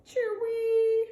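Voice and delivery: high, loud bird call; free birdsong. Acted. A free bird singing from a high branch, high and loud. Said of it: "another bird singing".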